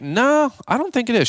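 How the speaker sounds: hammed-up doubtful singsong, very high; high-pitched doubt. Man doubting that the restaurant spins, mock high-pitched doubt. Acted doubt to his partner.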